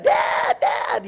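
put-on high-pitched child's cry for Dad; excited little kids. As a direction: call out like a small child greeting Dad. Voicing his excited young children.

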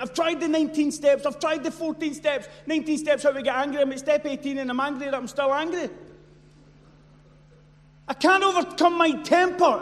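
loud, high, exasperated ranting; frustrated shout. Retold or imitated. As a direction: yell it out like someone fed up with failing. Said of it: "You think".

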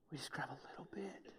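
whispered confidentially to the congregation. Real, not acted. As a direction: drop to a whisper for a moment. Aside dropped to a hush.

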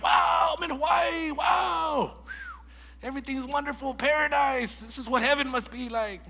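high, loud, wide-eyed wonder; awestruck. Retold or imitated. Arriving in Hawaii the first time, high and loud awe. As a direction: gasp at paradise.

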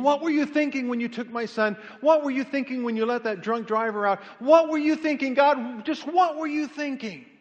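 high, loud cry at God; anguished scream at God. Retold or imitated. Grieving father screaming at God in the night, anguished. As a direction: shout it up at the night sky in grief. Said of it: "I would scream".

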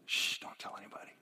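whispered shushing; hushed, quick whisper to confidants; hushed secrecy. Acted. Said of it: "Immediately after that, he says".

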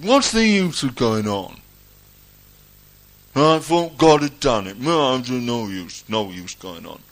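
loud mock moan, sinking toward the end; hammed-up defeatist wail that sags as it ends; imitated despairing complaint. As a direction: play someone giving up and accusing God. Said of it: "I've heard people talk like that".

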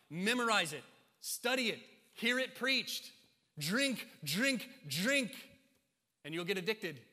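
high, loud sermon delivery; preaching at full force. Real, not acted. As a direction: punch out each command to the congregation.

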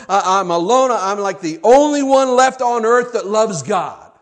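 loud, high, pleading outburst to God; desperate complaint. Acted. Elijah crying out to God, loud and desperate. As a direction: pour out Elijah's grievance, loud and raw. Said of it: "He says".

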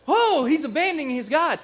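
high, loud gasp of alarm; shocked bystander. Retold or imitated. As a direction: cry it out like a scandalised listener. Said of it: "make people go".

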